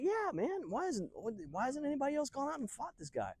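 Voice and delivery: high, eager disbelief; incredulous reply. Acted. David asks king why nobody fought, incredulous. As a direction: answer in a strained high pitch.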